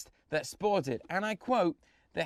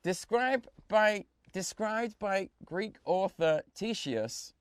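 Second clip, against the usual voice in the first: very slow, deliberate reading of "Ctesias" as "TEE-see-as"; careful slow pronunciation; 4 semitones higher, much slower than usual. Shown as a demonstration. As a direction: sound out the hard name carefully. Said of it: "Yeah, I pronounced that correctly".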